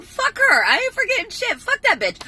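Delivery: high, loud outraged yell; furious yell. Retold or imitated. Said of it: "I was like".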